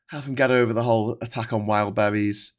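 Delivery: usual speaking voice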